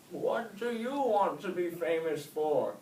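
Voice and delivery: high, slow, loud wise snail; old snail questioning young Oscar. A narrator's reading. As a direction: ask slowly like an old sage.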